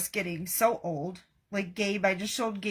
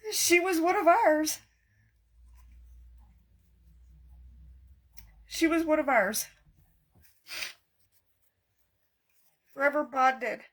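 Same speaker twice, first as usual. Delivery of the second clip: high voice breaking with real grief; tearful; 6 semitones higher than usual. Real, not acted. Crafter promising viewers she'll get it together, tearful. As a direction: try to hold it together through tears. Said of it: "I'm like a blubbering idiot".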